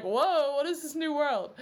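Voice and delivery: high-pitched, hammed-up wonder; acted amazement. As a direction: play someone stunned by what they see. Acts out character's amazement.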